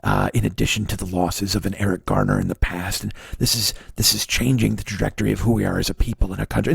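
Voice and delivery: low, fake-somber red alert; mock Michael Moore. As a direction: keep the fake-somber pundit going. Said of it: "that Michael Moore bullshit".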